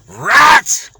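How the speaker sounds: spat insult. Real, not acted. Insulting Fauci in angry outburst.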